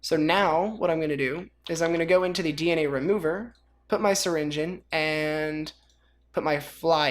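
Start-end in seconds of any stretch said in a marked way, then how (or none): none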